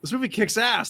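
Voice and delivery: high, eager fan voice; retold excitement. Fan raving to friends who disliked it, high and excited. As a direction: pitch it way up, thrilled with the film. Retelling his excitement after the movie.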